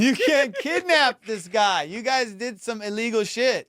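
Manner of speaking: high, drawn-out disbelief; incredulous scolding. Retold or imitated. Cop telling them they can't kidnap the kid, incredulous scolding.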